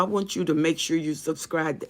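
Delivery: ordinary delivery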